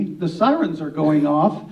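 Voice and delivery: ordinary talk, nothing marked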